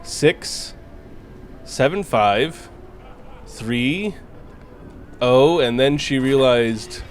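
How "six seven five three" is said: slow digits with pauses between; slowly recited. Retold or imitated. Employee giving manager a prank number, slowly. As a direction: dole out the phone number bit by bit. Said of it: "and then slowly we said".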